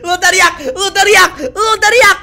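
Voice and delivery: loud, high taunting chant, building; laughing, escalating yell of triumph; gloating shout. Real, not acted. Gloats that the friend screamed.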